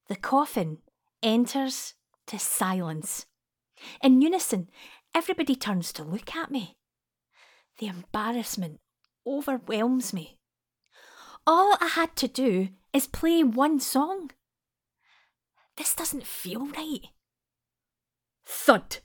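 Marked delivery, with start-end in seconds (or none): none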